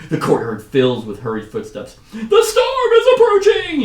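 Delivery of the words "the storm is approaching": very high, drawn-out yell; frantic shout. Acted. Hunters warning of the storm, high frantic shouting. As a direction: cry out an alarm at the top of your range. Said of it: "shouts the hunters".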